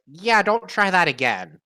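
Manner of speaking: loud, high in-character warning. Acted. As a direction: bark it at the cultists. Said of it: "Zuck is gonna be like".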